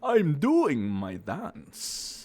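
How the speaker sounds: put-on slow camp Russian accent.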